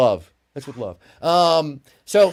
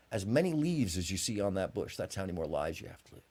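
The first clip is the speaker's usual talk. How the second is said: calm, quiet answer; 8 semitones lower, 9 dB quieter than usual. Acted. Wise man gives the answer.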